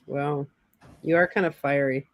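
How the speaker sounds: ordinary talk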